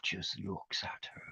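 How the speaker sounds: hushed suspenseful whisper. A narrator's reading. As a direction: whisper it to build dread. Suspense in the story.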